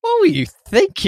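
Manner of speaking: high, incredulous mock cry; mock exasperation. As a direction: squeal it in disbelief. Mock outrage at filmmakers.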